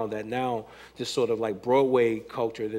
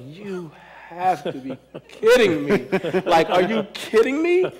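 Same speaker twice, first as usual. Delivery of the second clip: high, louder incredulous laugh-talk; laughing at the absurd tights; laughing disbelief; 5 semitones higher, 4 dB louder than usual. Retold or imitated. Seeing hip hop tights in store, laughing disbelief. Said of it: "And I was like".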